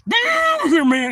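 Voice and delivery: very high yell; high shout. Retold or imitated. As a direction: scream it out. Shout from creek.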